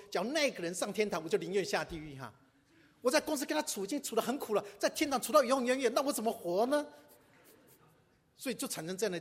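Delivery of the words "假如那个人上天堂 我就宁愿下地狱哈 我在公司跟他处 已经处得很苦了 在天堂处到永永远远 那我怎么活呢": higher and louder, fed-up complaining; exasperated coworker. Retold or imitated. Coworker griping about a churchgoer colleague, exasperated. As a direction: groan at spending eternity with him. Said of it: "甚至人家还告诉你说".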